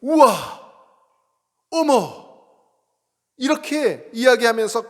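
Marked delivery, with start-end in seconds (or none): 0.0-2.3 high, loud wowed cries; amazed exclamations. Retold or imitated. Member amazed at the pastor's quick help. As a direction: gush with delighted amazement. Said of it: "그분은 이렇게 얘기해요".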